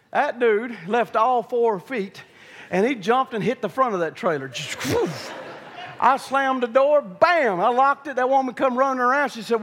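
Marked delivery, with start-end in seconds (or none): 4.9-5.3 rushing burst of air for impact; imitated whoosh. Bull leaping into the trailer's front, imitated whoosh. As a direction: whoosh like a heavy body flying.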